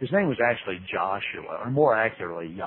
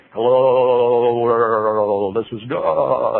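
louder, slow booming announcement; God on the microphone; 9 dB louder, much slower than usual. Pretend. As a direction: announce it to the whole world. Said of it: "walk up to that microphone and say".

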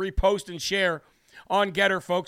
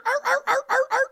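mock squeaky growl. Mocking a politician after clip.

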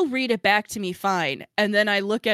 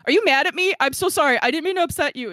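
higher, louder, rushed worried pleading; anxious apology; 7 semitones higher, 5 dB louder than usual. Retold or imitated. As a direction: fret that they're angry at you. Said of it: "I was like".

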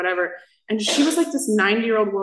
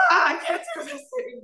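very high, wailing mock meltdown; comic crying freak-out; mock despair. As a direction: squeal it like a hammed-up breakdown, half laughing. Acting out a bad day.

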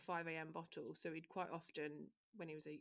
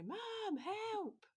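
put-on high, plaintive child's cry for help; mimicking a toddler calling for mum; small child calling; 5 dB louder than usual. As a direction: call out like a scared little boy. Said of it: "I heard him go".

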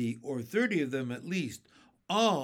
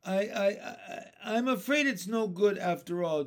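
ordinary delivery

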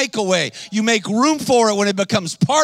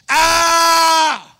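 long high loud scream; woman's retold scream; 9 semitones higher, 6 dB louder than usual. Woman in my office screaming, high mock scream. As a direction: imitate her screaming, full and sustained. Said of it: "she starts screaming in my office".

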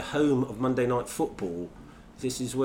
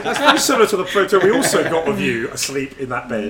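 louder, laughing through the words; laughing while quoting; 4 semitones higher, 7 dB louder than usual. Retold or imitated. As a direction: quote spilling over with laughter. Retelling teasing remark while laughing.

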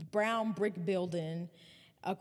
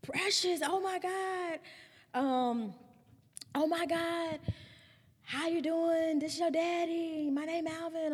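high, eager voice of a long-lost dad; super excited; 10 semitones higher than usual. Retold or imitated. Alvin calling his daughter for the first time, excited and high. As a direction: gush like a father meeting his child at last. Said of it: "super excited".

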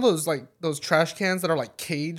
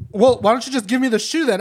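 mock demand; 7 semitones higher, 7 dB louder than usual. Lady demanding the shoe.

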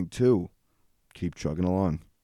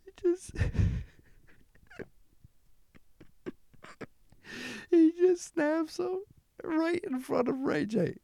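squeaky, high-pitched laughter through the words; can barely get the story out for laughing; real giggling fit while retelling the clip; laughing while talking; 4 dB louder, slower than usual. Real, not acted. Cracking up at the video.

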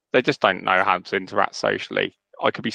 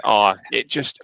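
ordinary talk

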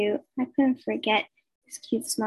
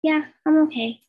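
plain reading voice

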